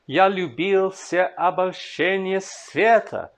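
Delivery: slow, measured reading that leans on each stressed beat; slow Russian verse recital; slow poetry recitation. Shown as a demonstration. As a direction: read the verse slowly, marking the rhythm.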